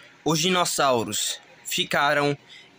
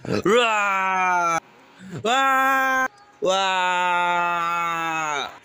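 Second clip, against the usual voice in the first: long open-mouthed mock roar; play roar; much slower than usual. Creature jumps out at the dinosaurs, roaring. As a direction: draw out a pretend monster roar.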